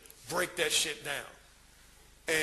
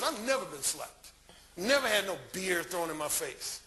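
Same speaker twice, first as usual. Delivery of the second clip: ordinary talk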